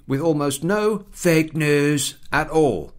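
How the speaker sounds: ordinary talk, nothing marked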